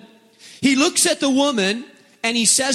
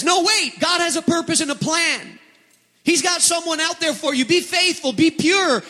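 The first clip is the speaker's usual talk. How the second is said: much higher, bright pleading urgency; encouraging inner voice; 10 semitones higher than usual. Acted. Pastor voicing the hopeful voice to the congregation, higher. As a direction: lift it up with hope.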